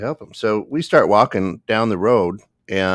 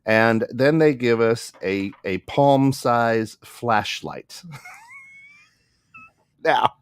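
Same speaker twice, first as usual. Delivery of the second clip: ordinary delivery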